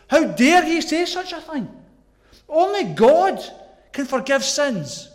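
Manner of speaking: loud, high shocked indignation; appalled outrage. Acted. Preacher voicing shocked religious leaders, indignant and appalled. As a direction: voice the scandalised objection, hitting it hard. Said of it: "were shocked. They were appalled".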